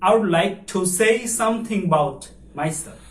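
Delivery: slow, careful model read; slow model sentence. Shown as a demonstration. Teacher repeats the full sentence slowly for the class. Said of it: "তাহলে আমরা কি বলছি?".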